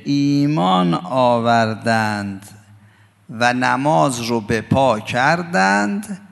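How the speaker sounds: deliberate, weighty preacher's emphasis; slow emphatic translation. Acted. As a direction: hammer home every word of the meaning.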